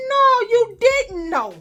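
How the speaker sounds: high, slow, pointed rebuke; acting out a heated telling-off; indignant scolding. Acted scolding of the girl.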